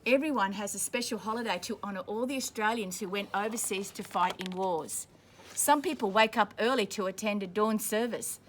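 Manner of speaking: ordinary delivery, nothing marked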